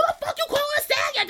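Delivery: high, fast laughing squeal; mock basic girl. Imitating a girl offended by the catcall, high and laughing. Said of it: "basic bitches would have been like".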